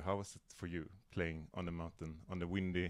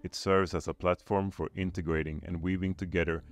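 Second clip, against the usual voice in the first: louder, measured scripted welcome; podcast intro read; 7 dB louder than usual. Acted. Host welcomes listeners to One Voice podcast, scripted intro read. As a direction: deliver a prepared mission statement to listeners.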